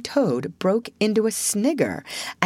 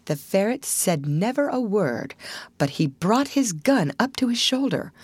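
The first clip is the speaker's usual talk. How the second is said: ordinary delivery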